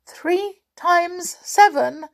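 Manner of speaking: high, slow, timid echoed question; scared little pixie stalling; frightened pixie. A narrator's reading. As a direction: repeat it nervously, pitched right up. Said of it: "with a frightened look".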